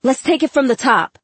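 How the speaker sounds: rally the band to restart; dad calling over instruments. A narrator's reading. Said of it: "Dad yelled over his conga".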